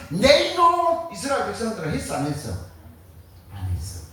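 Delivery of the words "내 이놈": slow, higher and louder rebuke; stern scolding shout. Retold or imitated. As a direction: bellow it like an angry God. God scolding the people. (높고 크게 천천히 꾸짖는 하나님)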